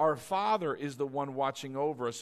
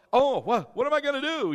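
mock panic; 6 semitones higher, 7 dB louder than usual. Acting out a worried person.

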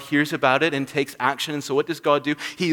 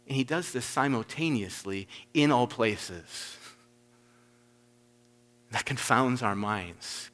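ordinary delivery

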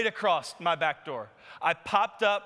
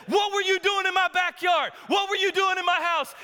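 angry demand; 10 semitones higher, 4 dB louder than usual. Retold or imitated. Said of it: "and I said".

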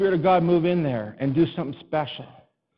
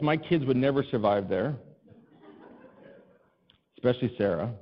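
normal talking voice

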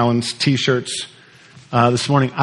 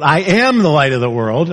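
"am" punched and held; weight thrown onto "am" right after "I"; stress on AM. Shown as a demonstration. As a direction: hit the word "am". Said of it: "he could have emphasized anything".

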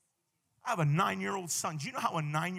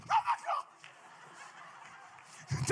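very high startled squeal; hammed-up fright; mock freak-out; 5 dB quieter than usual. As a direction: imitate a clerk scared out of her wits. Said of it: "Totally freaked".

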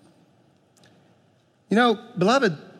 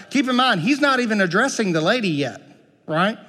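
usual speaking voice

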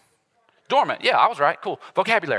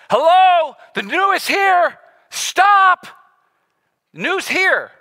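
Paul shouting; 13 semitones higher, 7 dB louder, much slower than usual. Retold or imitated. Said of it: "He's saying".

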